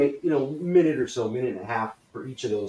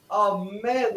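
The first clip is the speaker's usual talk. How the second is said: high, thrilled exclamation; excited; 7 semitones higher than usual. Shown as a demonstration. Coach models excitement for the class, thrilled. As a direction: exclaim it in delighted celebration. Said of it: "getting excited".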